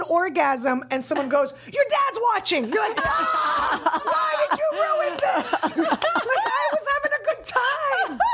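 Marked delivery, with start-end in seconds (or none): 1.7-2.7 high, shouted buzzkill warning; comic blurt. Pretend. Imagined interruption, comic. 3.1-8.2 high, laughing shouted protest; laughing outrage. Pretend. Imagined outraged reaction.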